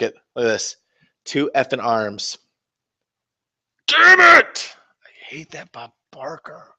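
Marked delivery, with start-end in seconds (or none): 3.9-4.7 high, loud shout; furious shout. Real, not acted. Cursing the broken models, shouting high and loud. 5.0-6.7 much softer whisper; whispered grumble. Real, not acted. Quiet whispered grudge after cursing the models.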